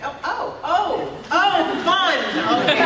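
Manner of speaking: high, excited string of ohs; mock-delighted reaction in a high voice; delighted realization. Imagined audience reaction to the line.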